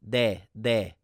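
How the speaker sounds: de said with the nose shut, N sound turned toward D; stuffy nose de. Shown as a demonstration. Teacher shows Korean yes with denasalized N. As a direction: say de as with a blocked nose. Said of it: "your nose is so stuffy".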